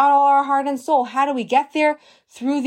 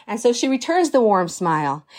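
ordinary delivery

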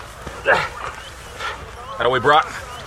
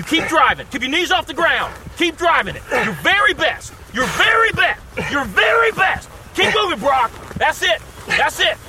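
urgent coaching shout; 7 semitones higher, 5 dB quieter than usual. Acted. Coach urging player on field.

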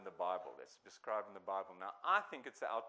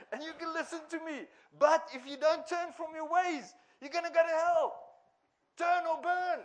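much higher, much louder, hammering the warning; fire and brimstone shout; 10 dB louder than usual. Retold or imitated. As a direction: bellow the threat of hell at the crowd. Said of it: "he'd say".

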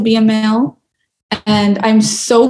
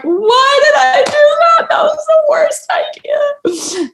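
high crying wail fading to a whisper; crying regret. Retold or imitated. Exhausted runner regretting the race, crying high. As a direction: sob it out high, then break into a whisper. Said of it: "Like, just so sad".

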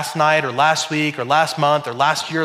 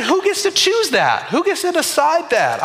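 high, indignant mock protest; mock outrage; 11 semitones higher than usual. Pastor mocking made-up holidays, high indignant questions. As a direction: fire the questions up high, half-joking. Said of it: "I said".

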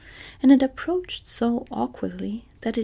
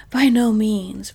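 with dignity; 10 dB louder, slower than usual. Acted. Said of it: "responded the Emperor with dignity".

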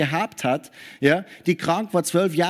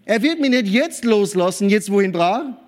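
triumphant believer; 5 semitones higher, 4 dB louder than usual. Pretend. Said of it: "dass wir sagen".

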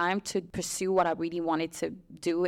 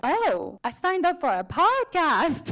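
high, slow surprised realization; startled realization; 9 semitones higher, 5 dB louder, slower than usual. Retold or imitated. Guest realizing the event was a podcast, surprised. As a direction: let it dawn on you, voice climbing. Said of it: "I was like".

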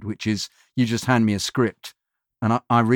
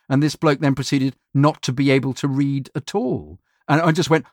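usual speaking voice

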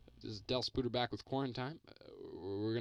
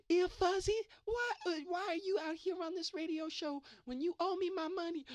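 much higher, strange put-on voice for a debt collector; put-on silly voice. Landlord at the bedroom door demanding his owed money, high put-on voice. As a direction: squeak it out like a cartoon creditor demanding cash. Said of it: "Why, why are you talking like that?".

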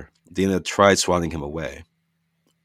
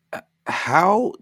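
stunned; 13 semitones higher, 5 dB louder than usual. Acted. Dina stunned by floating card.

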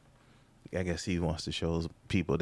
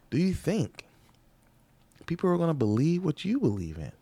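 incredulous rhetorical question; 9 semitones higher than usual. Real, not acted. Rhetorical challenge to audience.

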